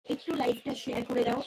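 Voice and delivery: ordinary delivery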